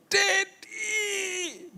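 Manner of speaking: put-on high, crying child's wail. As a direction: wail like a frightened little boy. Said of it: "dia nangis". (anak menangis memanggil ayahnya dengan suara tinggi)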